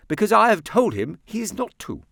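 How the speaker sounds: high, loud aunt voice; exasperated remark; aunt to herself. A narrator's reading. Aunt to herself, exasperated. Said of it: "as she remarked to herself".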